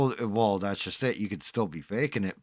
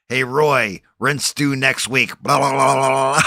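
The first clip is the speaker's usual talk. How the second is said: loud nagging landlady voice; 4 semitones higher, 11 dB louder than usual. Retold or imitated. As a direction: nag Roy loudly about the rent. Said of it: "the noise from the lady".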